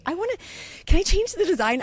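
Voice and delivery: high-pitched, pleading mock voice; eager request. Retold woman emailing about design.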